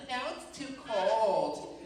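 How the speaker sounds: high, stretched-out whine; complaining. Acted. Passenger griping once the AC is on, whiny complaining. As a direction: whine as if nothing ever suits you. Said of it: "someone complain about everything".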